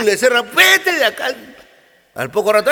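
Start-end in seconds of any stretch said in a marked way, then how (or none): 0.6-1.3 high, sharp dismissal; a higher mock shout of "get out of here"; shouting him away. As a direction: shove him out with your voice. Throwing the piglet out.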